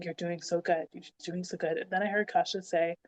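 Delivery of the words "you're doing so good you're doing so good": gentle encouragement. Retold or imitated. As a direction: reassure her quietly, imitating her partner. Partner encouraging her during labour.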